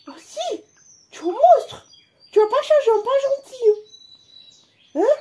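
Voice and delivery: high whining pet voice; whiny pet voice. Pretend. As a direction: whine like the pet. Pet voice scolding back.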